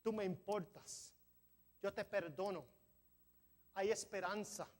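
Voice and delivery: normal talking voice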